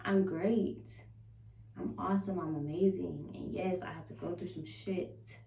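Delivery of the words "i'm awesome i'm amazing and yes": ordinary talk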